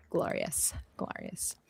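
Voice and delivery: very soft, breath-only reverence; hushed mock awe. Admiring the beautiful hair of Brazilians, hushed awe.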